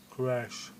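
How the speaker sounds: normal talking voice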